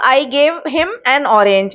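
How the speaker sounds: very slow, word by word example sentence; slow dictation. Shown as a demonstration. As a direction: spell out each word slowly for students. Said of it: "अब ये orange वाला मैं आपको".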